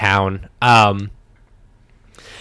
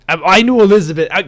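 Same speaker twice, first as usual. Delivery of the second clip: very fast, louder flustered blurt; flustered woodsman; 8 semitones higher, 6 dB louder, much faster than usual. Acted. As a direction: rush it out caught off guard.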